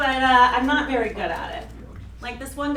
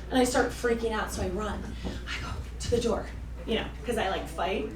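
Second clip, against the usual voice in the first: usual speaking voice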